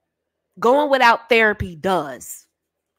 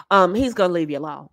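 usual speaking voice

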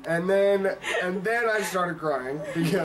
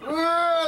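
laughing cry; 10 semitones higher, 3 dB louder than usual. Real, not acted. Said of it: "laughing while telling".

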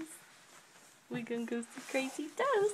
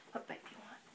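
whispering; 13 dB quieter, much faster than usual. Real, not acted. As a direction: breathe it in a whisper. Whispering to baby.

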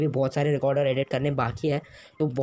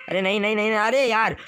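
high, loud frantic no's; frantic shout; 7 semitones higher, 3 dB louder than usual. Real, not acted. Gamer losing his loot to an enemy, frantic loud shout. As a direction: shout them out in real panic.

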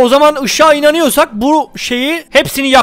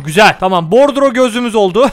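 usual speaking voice

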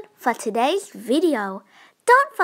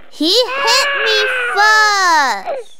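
wailing, louder and higher, slow; crying shout; 7 semitones higher, 5 dB louder, slower than usual. Acted. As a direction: sob it out as a hurt tattletale kid. Child crying to parent after fight.

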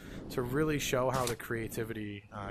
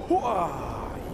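loud gung-ho soldier; 2 semitones higher, 7 dB louder than usual. Real, not acted. Swinging off web, excited cry.

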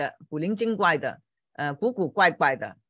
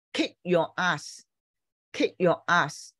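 slow teaching demo; much slower than usual. Shown as a demonstration. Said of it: "polite是很有礼貌".

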